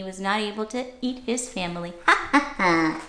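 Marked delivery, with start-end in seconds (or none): none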